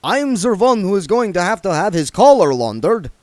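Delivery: fussy vampire snapping at a dwarf; snapped. Acted. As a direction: snap back about the laundry. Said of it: "snapped Otto".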